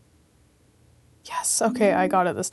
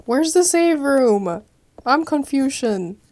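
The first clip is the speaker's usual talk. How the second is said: exasperated; 6 semitones higher, 6 dB louder, slower than usual. Real, not acted. Exasperated, lost in the game.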